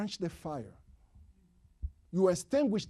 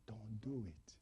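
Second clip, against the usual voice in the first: soft whisper, much softer; whispered plea; 8 semitones lower, 15 dB quieter than usual. Acted. Preacher whispering a warning to the congregation. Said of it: "earnest plea".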